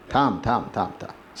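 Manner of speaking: low, hushed settling voice; calming down. Acted. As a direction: soothe softly, dropping almost to a murmur. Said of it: "शांत कर म्हणून".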